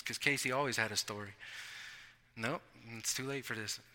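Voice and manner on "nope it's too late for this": very soft, low mumbled refusal; sleepy refusal. Retold or imitated. Pretending to sleep through Casey's calls, a soft low grumble. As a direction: grumble it under your breath, half asleep. Ignoring Casey half asleep.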